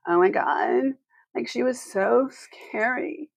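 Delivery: put-on slow creaky Valley girl drawl; vocal fry. Shown as a demonstration. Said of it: "I did, uh, on my vocal fry".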